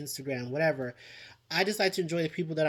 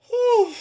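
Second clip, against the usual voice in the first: exasperated whew; 9 dB louder than usual. Real, not acted. Exasperated exhale.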